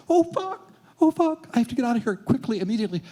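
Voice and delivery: very high, frantic panic; panicked squeal. Retold or imitated. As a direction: squeal it like you're trapped at the top. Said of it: "it's like".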